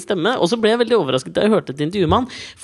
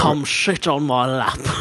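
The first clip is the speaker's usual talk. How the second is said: slow, lower Sean Connery voice; Sean Connery impression; 6 semitones lower, much slower than usual. Teasing his co-host with a slow, low Connery voice. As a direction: ham up the Scottish actor, slow and low. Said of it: "du er Sean Connery".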